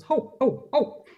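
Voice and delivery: high honking ho ho ho; gander cackle. Acted. Nurse mocking Telegin as a cackling gander, high honks. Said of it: "The gander cackles".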